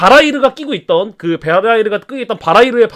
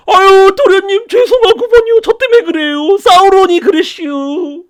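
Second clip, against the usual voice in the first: much higher, louder country-dialect lament; dead servant confessing to young master; wailing ghost servant; 11 semitones higher, 3 dB louder, slower than usual. Acted. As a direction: plead for forgiveness.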